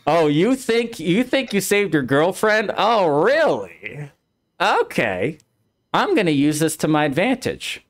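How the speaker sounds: mock-taunting villain, pitched high; Sephiroth. Sephiroth gloating at Cloud over his girlfriend, mock-taunting. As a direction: gloat in a high, sarcastic sing-song. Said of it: "Sephiroth's like".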